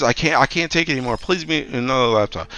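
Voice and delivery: ordinary talk, nothing marked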